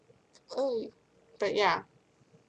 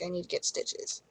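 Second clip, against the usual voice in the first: glum admission; 3 semitones lower, 10 dB quieter than usual. Retold or imitated. Glum reply after bad news.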